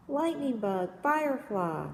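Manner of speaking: a hint of an excited little kid shout; mild mock child's call. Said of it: "you'll hear a little kid shout".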